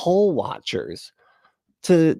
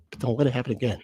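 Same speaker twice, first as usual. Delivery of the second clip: ordinary delivery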